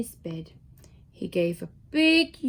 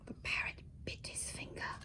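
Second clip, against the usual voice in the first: whisper; 19 dB quieter, faster than usual. Real, not acted. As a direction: lean in and whisper it. Reader's aside to child listener.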